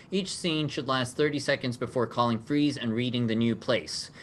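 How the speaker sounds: ordinary talk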